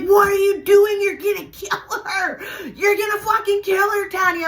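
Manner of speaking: high, loud imitation of horrified sister; panicked scream. Sister grabbing Tanya's wrist in the fight, panicked scream. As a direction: shriek to stop her, re-enacted.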